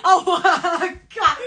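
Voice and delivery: ordinary delivery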